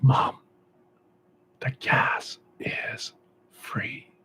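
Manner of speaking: slow, secret whisper. Acted. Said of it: "Free, I say".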